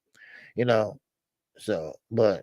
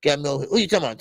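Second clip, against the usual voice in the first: ordinary delivery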